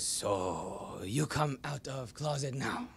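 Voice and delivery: a slow, lightly voiced old grandfather, half laughing; imitating grandfather. Imitating the grandfather congratulating his grandson on coming out, slow and amused. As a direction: gently mimic an old man's broken English. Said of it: "He goes".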